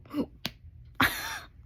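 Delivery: a sharp, high gasp; re-enacted gasp. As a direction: gasp in sudden shock. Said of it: "I go".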